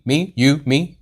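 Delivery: ordinary delivery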